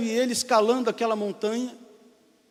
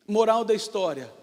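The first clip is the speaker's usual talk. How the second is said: usual speaking voice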